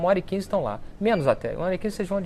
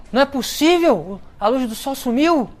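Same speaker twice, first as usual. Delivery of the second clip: higher, louder cry of disbelief; astonished exclaim; 8 semitones higher, 4 dB louder than usual. Retold or imitated. Friar staring into the sun, crying out in astonishment. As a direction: gasp it out like you can't believe your eyes.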